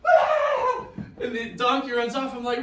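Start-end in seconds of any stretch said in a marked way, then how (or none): 0.1-0.9 sudden high yelp breaking into a laugh; startled laughing yelp. Acted. Balaam yelps as his donkey runs off, laughing.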